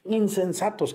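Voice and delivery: "Insensatos" said high with a falling close; exclamado. Shown as a demonstration. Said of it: "Aquí vienen los signos de admiración". ("Insensatos" exclamado, agudo y con caída final)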